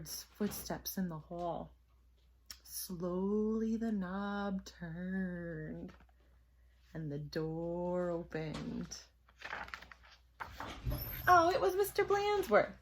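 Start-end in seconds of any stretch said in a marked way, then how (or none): 2.6-9.0 drawn-out, softer suspense read; slow suspense. A narrator's reading. Suspense narration. 11.3-12.7 high, loud relieved reveal; sudden relief in the voice; relieved surprise. A narrator's reading.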